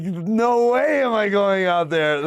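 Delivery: high, slow panicked no; scared refusal. Retold or imitated. Narrator refusing to go out to the gangsters, high scared voice. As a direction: refuse in a fright.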